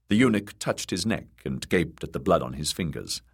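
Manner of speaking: ordinary delivery, nothing marked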